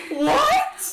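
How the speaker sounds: high, loud screech; screamed disbelief. Acted. Game master as Archeon answering a threat, screaming. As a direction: shriek it in disbelief. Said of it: "Archeon screams".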